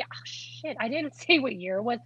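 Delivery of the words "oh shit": barely voiced swear under breath; muttered curse. Real, not acted. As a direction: catch yourself and curse under your breath. Realizing a mistake.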